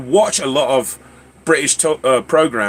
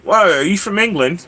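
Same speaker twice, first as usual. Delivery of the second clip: higher, mock-amazed question; surprised customer; 6 semitones higher than usual. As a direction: imitate a customer startled by the accent. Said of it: "hear my accent and go".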